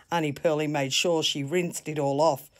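plain reading voice

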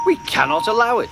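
high, fast indignant protest; indignant outrage. A narrator's reading. Henry objecting to Duck's tales, indignant. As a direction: forbid it in high-pitched outrage.

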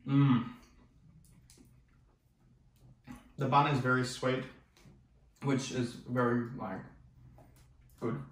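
talking while chewing; mouth full. Real, not acted. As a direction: food in mouth. Seen 3: Takes bites just before; closed full-cheeked mouth while talking, burger held.

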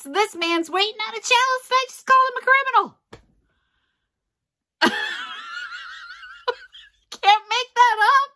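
high, quick words breaking into a real laugh; laughing in disbelief. Real, not acted. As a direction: laugh at your own mistake. Laughing at her own blunder.

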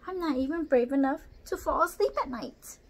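lightly voiced nervous orangutan; timid orangutan. A narrator's reading. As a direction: speak as a shy, fearful orangutan. Timid orangutan confesses fears.

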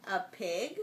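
ordinary delivery

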